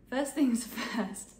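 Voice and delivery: laughing through a fumbled opener; laughing at a flub. Real, not acted. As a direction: giggle mid-phrase after tripping over the words. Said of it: "good start".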